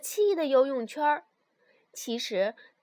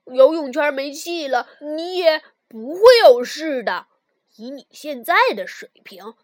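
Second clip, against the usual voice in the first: reassuring; 12 dB louder than usual. Acted. Friend reassuring loudly.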